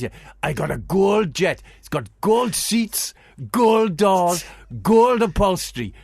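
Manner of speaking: put-on loud, high boasting Pakistani accent. [name] listing his gold jet, in a Pakistani accent. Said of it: "Pakistani accent".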